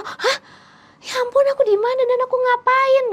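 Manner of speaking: high, shocked cry of panic. Retold or imitated. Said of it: "kayak kaget gitu". (kaget panik dengan suara tinggi)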